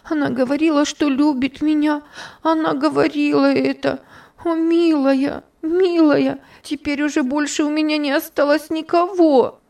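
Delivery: put-on orphan girl sobs for her lost friend; sobbing lament. A narrator's reading. High sobbing lament. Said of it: "И она зарыдала вновь".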